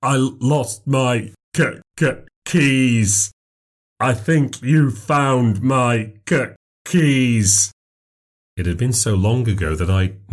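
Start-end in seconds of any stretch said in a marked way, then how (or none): 0.0-7.7 slow, higher, stuttering on every k. Acted. Stranger at the door stutters slowly about lost keys. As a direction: drag out each stuck k like a stutterer. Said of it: "said slowly like a man with a stutter".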